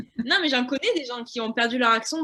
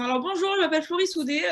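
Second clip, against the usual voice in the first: put-on light southwestern French accent, a bit higher; playing up a mild south-west accent for the jury; 6 semitones higher than usual. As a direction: introduce yourself with a sunny southwest lilt. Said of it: "avec mon accent".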